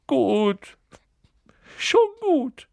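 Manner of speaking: high, wailing surrender; whimpered, high-pitched submission; Quirrell sobbing. Acted. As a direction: give in with a tearful sob. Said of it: "hörte er Quirrell schluchzen".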